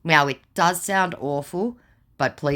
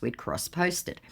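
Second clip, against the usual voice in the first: usual speaking voice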